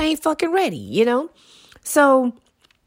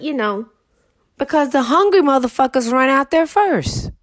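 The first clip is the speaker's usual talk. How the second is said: ordinary talk, nothing marked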